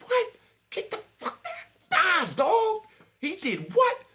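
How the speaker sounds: high, loud, stunned disbelief. Retold or imitated. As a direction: act out shocked street gossip, pitch shooting way up.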